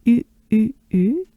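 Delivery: U repeated as a pure French "ü"; three separate U sounds, "ee" said through rounded lips; droopy-mouthed French U. Shown as a demonstration. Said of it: "droopy mouth".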